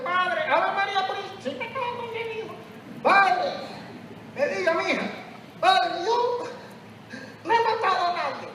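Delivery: put-on high, meek little old lady voice; old woman confessing. Old woman confessing to the priest, high and timid.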